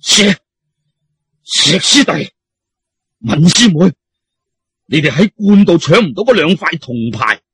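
out-of-breath accusing Taoist; breathless accusation. Acted. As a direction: accuse them while short of breath. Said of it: "沖虛氣嘘氣喘".

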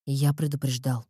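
low, cold gloomy voice; dark lord. A narrator's reading. Erik gloomy rebuke.

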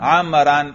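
عمران pronounced "amran", with an -an ending; nasb ending. Shown as a demonstration. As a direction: end عمران on a clear "-an". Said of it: "اور اس پہ نصب پر".

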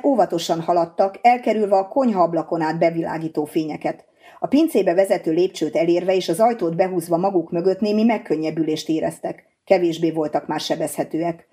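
ordinary delivery

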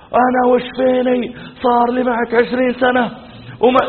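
mock a protesting wail, pitch held high; imitated wife's outcry. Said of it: "إلا صرخت وخذت غطوتها". (تقليد صراخ زوجة محتجة)

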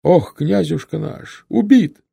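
high, loud cry of grief; slow lament rising to a loud cry. A narrator's reading. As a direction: wail it out at the shocking sight. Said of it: "вскрикнул, посмотрев на телегу".

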